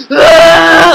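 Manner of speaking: loud, high wail after a gasp; gasp, then let out a sudden loud cry; imitated crying wail. Telling of bursting into tears outside the hostel, imitated crying wail. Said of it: "one of those deep girl cries".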